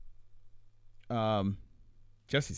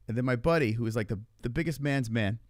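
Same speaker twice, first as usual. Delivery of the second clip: ordinary talk, nothing marked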